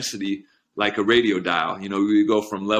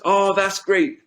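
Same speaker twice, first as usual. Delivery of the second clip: higher, louder delighted exclaim; cheering crowd; 10 semitones higher, 4 dB louder, slower than usual. Retold or imitated. Villagers in the parable cheering the good news, delighted and higher. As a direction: play the villagers gushing over the new horse. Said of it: "everyone in the community says".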